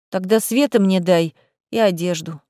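plain reading voice

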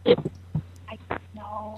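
whispering on the phone. Real, not acted. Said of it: "Why are you whispering?".

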